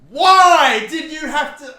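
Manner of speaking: high, loud, slow wail; anguished cry. Real, not acted. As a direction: cry out at the game in despair. Anguish at the match.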